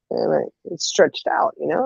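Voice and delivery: ordinary talk, nothing marked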